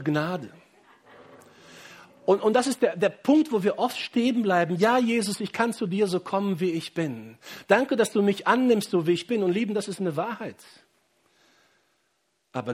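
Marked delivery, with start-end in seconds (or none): 2.2-10.6 raised, higher preaching voice pressing the point; fervent preaching. Acted. Pastor urging his congregation, fervent raised preaching. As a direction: preach it out to the whole church.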